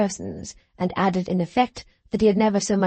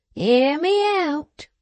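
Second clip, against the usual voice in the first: slow insistent Wegg; 7 semitones higher, much slower than usual. A narrator's reading.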